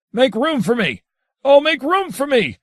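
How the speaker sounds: thundering cry. A narrator's reading. Adrian of the Mountain in transport of passion, thundering cry. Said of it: "cried out in a voice that had its own crackling thunder".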